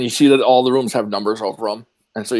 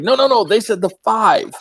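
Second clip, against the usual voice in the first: insistent doorman; 8 semitones higher than usual. Acted. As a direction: hold the line, no six allowed. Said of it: "He's like".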